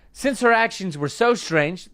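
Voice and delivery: normal talking voice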